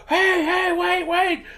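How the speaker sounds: high-pitched panicked pleading; acted-out freak-out, voice way up; mock panic. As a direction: plead for your life, hammed up. Rocco's freaking out.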